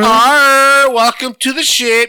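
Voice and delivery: mild pirate captain greeting; hammy pirate welcome. Pretend. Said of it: "It's like".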